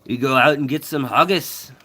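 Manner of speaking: jokey Scottish lilt, lifting at the end; hammed-up Scots among the family; put-on Scottish accent. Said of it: "when I'm around my Scottish family, I'm like".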